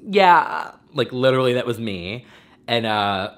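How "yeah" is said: high, loud, quavering yeah; hammed-up teary yeah. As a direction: imitate a tearful celebrity's yeah. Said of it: "she's like".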